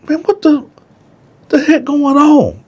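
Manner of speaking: the narrator sitting up in bed, confused by the noise. Retold or imitated. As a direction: wake up baffled and ask.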